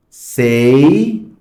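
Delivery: ordinary talk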